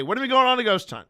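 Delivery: high, loud and fast, teasing eagerness; excited call-out. Retold or imitated. Streamer hyping a friend's chat about ghost hunts, high fast excited call. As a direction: shout it across the stream, all hyped up. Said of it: "I would say".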